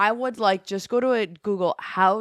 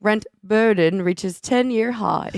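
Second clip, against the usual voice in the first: loud, slow British headline read; deliberate, drawn-out British accent; attempted British accent; 6 dB louder, slower than usual. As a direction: push through in amateur British. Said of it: "give me the headline".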